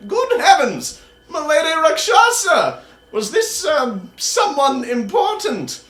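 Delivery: put-on high old wizard taken aback by a body on his table; surprised old wizard. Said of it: "Delden looks quite surprised and goes".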